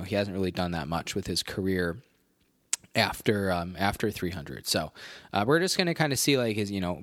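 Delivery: ordinary talk, nothing marked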